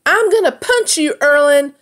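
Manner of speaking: very high, loud scream. A narrator's reading. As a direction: shriek the threat at him. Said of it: "Anir screamed".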